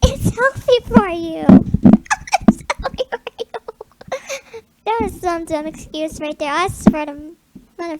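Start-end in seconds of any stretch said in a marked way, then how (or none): 0.0-1.5 mock insistent, higher and louder; louder, higher mock insistence. Repeats line louder and higher. 1.5-2.4 squeaky laugh. Real, not acted. 2.5-4.6 silly voice. Pretend. Silly put-on voice.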